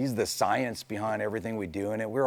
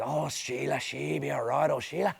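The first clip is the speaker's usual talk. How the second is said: put-on Australian accent; 6 semitones higher than usual. The builder fondly talking to the car in a light mock Australian accent. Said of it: "He was doing it as Australian voice".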